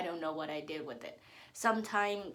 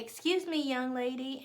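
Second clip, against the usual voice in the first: higher mimicking voice calling for attention; imitated stranger; 5 semitones higher than usual. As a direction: mimic a man hailing someone, pitched up. Imitates stranger addressing her again.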